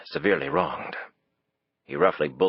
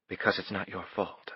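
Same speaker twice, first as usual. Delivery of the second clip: gentle; 2 semitones higher, 8 dB quieter than usual. A narrator's reading. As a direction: comfort him quietly. Faith voiced softly.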